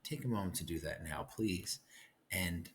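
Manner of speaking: ordinary talk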